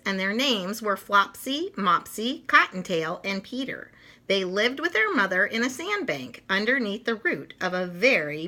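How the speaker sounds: lively read-aloud for little ones; storybook narration. A narrator's reading. Reading Peter Rabbit to children, animated storytelling. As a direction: tell it like a bedtime picture book.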